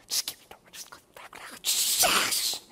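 whispered pleading, barely voiced; urgent whisper. Abishai begging David beside sleeping Saul, urgent whisper. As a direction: whisper it so the sleepers don't hear.

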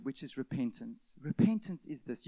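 normal talking voice

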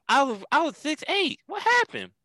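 very high, squeaky Tyson dismay; Mike Tyson impression. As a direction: cry out in a tiny high Tyson voice. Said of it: "The guy was six eight, and he's in Mike Tyson".

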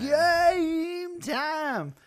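high, loud game show call; announcer shout. Acted. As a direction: belt it like a host. Hosts announce game segment.

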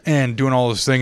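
normal talking voice

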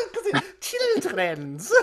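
high, slow, laughing through it; can't stop laughing at the word; cracking up over his own slip. Real, not acted. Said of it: "children's in it".

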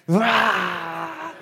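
loud mock toddler roar; imitating a child's primal scream. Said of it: "she lets out this primal scream".

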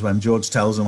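usual speaking voice

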